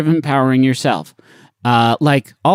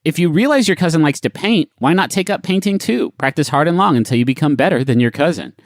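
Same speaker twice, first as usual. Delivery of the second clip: usual speaking voice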